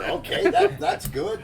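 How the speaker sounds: high, loud laughing words. Real, not acted. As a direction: laugh through it. Laughing at player.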